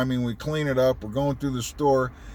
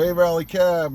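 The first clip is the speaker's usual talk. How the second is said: lightly acted phone pickup, higher and louder; mock phone greeting, pitched up; 4 semitones higher, 5 dB louder than usual. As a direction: answer the cab line, a touch of dispatcher. Acted answering of the cab phone.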